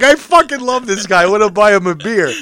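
gleeful, cracking up; laughing delight. Retold or imitated. Delighted at the other driver, laughing.

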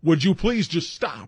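hammed-up fed-up begging; exasperated plea. Retold plea to classmate with accent.

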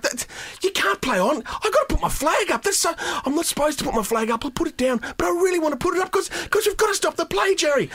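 high, fretful dithering; hammed-up nervous linesman; mock touch judge. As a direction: voice an anxious official torn both ways. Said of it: "the touch judge ... is like".

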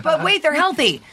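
high-pitched retold objection; playful imitation of own dismay; mock protest. Retells own protest to designer.

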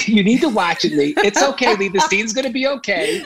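mock-soothe yourself like a cast member would; imitating his actor. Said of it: "this is how my actors talk to me".

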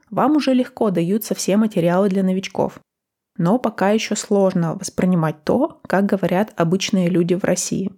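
usual speaking voice

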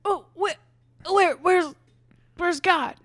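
high, loud, lost little voice; confused high voice. Acted. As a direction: squeak it out, baffled on the spaceship. Rhonda confused aboard the UFO.

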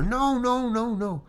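yelling at the mirror. Retold or imitated. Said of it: "I'm yelling at the mirror".

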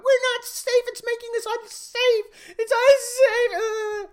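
high, loud mock whine; hammed-up high wail of fear; mock whining. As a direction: whine it like a crybaby staffer. Mocking woke staffers' complaint.

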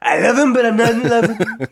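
giggling through a mock confession; breaking into laughs mid-line; laughing confession.

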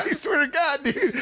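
high-pitched words squeezed out through laughter; barely able to speak for laughing; laughing hard. Real, not acted. Cracking up at dad story.

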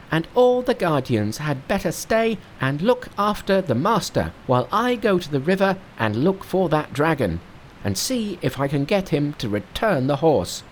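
high, bright-pitched monkey king. Acted. Narrator voicing Monkey to his master, high monkey voice. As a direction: play the cocky monkey hero up high.

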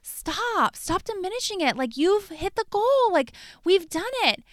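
high, pleading insistence; insistent. Retold or imitated. Pushing husband to celebrate.

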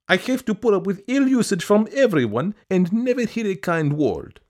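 high, pleading woman's voice; pleading petitioner. A narrator's reading. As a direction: beg in a high, fussy voice. Petitioner complaining to the official.

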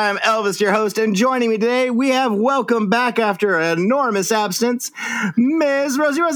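high-pitched hype, building to the name; show announcer intro. Real, not acted. Host welcomes back a long-absent co-host, building fanfare.

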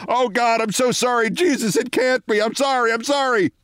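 high, wailing string of apologies; mock anguished apology. Mortified rival apologizing, hammed-up high anguish. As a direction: plead it in a high put-on wail. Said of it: "Bill is shouting apologies".

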